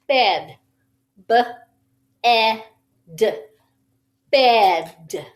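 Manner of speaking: "Bed", then "B-E-D" sound by sound, then "Bed" blended; segmenting bed. Shown as a demonstration. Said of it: "stretch that arm out. tap it, slide it".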